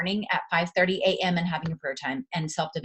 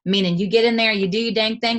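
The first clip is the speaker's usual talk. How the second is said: ordinary talk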